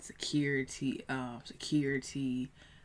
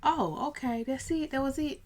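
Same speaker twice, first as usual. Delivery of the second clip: mom's reply; 11 semitones higher, 5 dB louder than usual. Retold or imitated. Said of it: "to him like".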